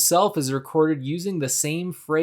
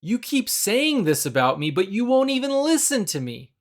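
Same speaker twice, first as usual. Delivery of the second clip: frustrated protest; 7 semitones higher than usual. Retold or imitated.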